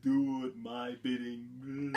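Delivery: slow, eerie sorceress command; mock the menacing witch giving orders; creepy witch. Vlogger imitating the scary Enchantress, slow and eerie. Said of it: "just super creepy and would be scary".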